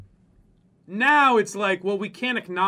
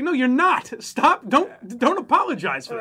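higher, louder stern stop; firm scolding; 6 semitones higher, 6 dB louder than usual. Pretend. Telling the apologizer to stop, firm and scolding. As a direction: tell them off firmly.

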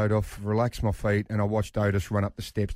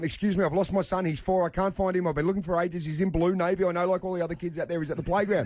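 breathless rush of higher-pitched pleading; frantic; 7 semitones higher, faster than usual. Retold or imitated. Panicked dad reporting lost son at information window, frantic. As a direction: reel it off like a panicking parent, retold.

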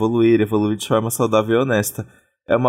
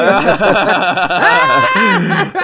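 shrieking laugh; 10 semitones higher, 6 dB louder than usual. Real, not acted. Mocking homophobic worry.

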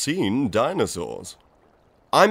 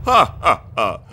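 hearty stage laugh; 4 dB louder than usual. Acted. Professor's trademark laugh.

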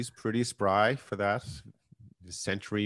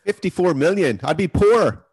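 loud, higher incredulous joke; excited outburst; 7 semitones higher, 8 dB louder than usual. Real, not acted. Co-host mocking the huge app cost, loud and incredulous. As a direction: blurt it out, amazed and loud.

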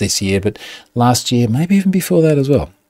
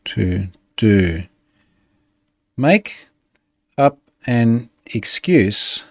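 slow dictation; slower than usual. Shown as a demonstration. Dictating prompt to ChatGPT.

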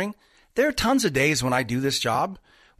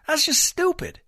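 exasperated scoff; 10 semitones higher than usual. Real, not acted. Exasperated remark on risky investing.

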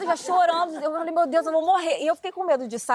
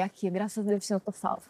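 quiet relief; 5 semitones lower, 6 dB quieter than usual. Retold or imitated. Relieved whisper to herself.

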